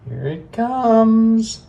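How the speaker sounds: high, drawn-out cartoon call; slow character call. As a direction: stretch it out in a high voice. Character calls out, slow, far.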